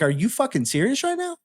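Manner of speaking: can't believe what he's hearing, voice climbing high; incredulous disbelief. Retold or imitated. To the finance manager, retold, high incredulous disbelief. Retelling the clash with the dealer.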